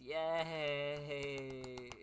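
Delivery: long drawn-out, unenthusiastic "yay"; mock cheer. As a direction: cheer it with fake joy, stretching the vowel. Fake cheer at exposition time.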